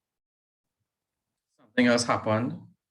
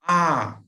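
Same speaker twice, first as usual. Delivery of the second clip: mock scream; 6 semitones higher, 5 dB louder than usual. Reading the scream in story.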